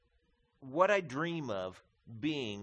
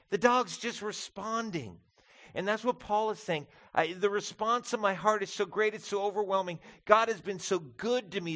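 high, passionate sermon voice; fervent preaching; 6 semitones higher, 4 dB louder than usual. Acted. Pastor to congregation on Paul's calling, fervent preaching. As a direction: preach it with overflowing gratitude.

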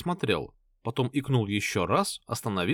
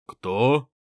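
surprised; 5 dB louder than usual. A narrator's reading. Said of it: "удивился мужик со шрамами".

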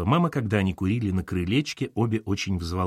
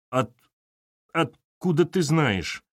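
higher, slow, halting mock-tearful question; sobbing; 6 semitones higher, slower than usual. A narrator's reading. Katerina questions boss on phone, acted sobbing. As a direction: squeeze it out haltingly through acted sobs. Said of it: "всхлипывая, выдавила из себя Катерина".

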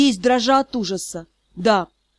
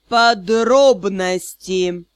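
mocking, syllable by syllable; 5 semitones lower, much slower than usual. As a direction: lower and very slow, each syllable apart. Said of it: "издевательски и по слогам произнёс Риан".

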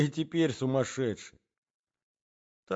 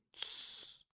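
hushing whisper; 17 dB quieter than usual. A narrator's reading. Hiding under bed, silencing.

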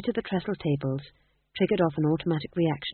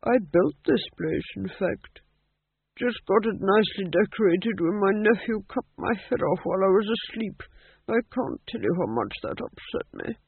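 lightly voiced, higher old king; chatty old ghost king; 4 semitones higher than usual. A narrator's reading. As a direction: reminisce airily, mildly put out.

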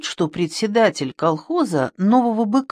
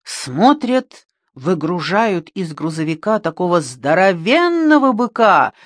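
plain reading voice

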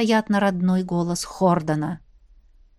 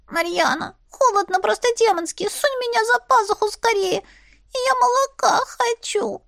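much higher, squeaky little grumbler; squeaky kitten voice; 11 semitones higher than usual. Acted. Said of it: "писклявым голосочком".

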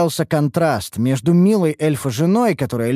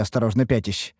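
usual speaking voice

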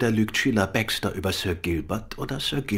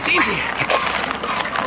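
straining effort; 12 semitones higher, much slower than usual. Acted. Hauling a body aboard.

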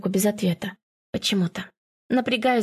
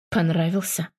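lightly voiced man, lower pitch; understated, low-pitched strained question; not his own voice; 4 semitones lower than usual. A narrator's reading. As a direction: drop it low, as if the voice isn't quite his. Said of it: "Не своим голосом говорит".

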